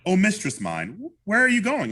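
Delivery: "where are you going" leaping up high; playful, high-pitched asking; questioning. Shown as a demonstration. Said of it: "It's literally a question".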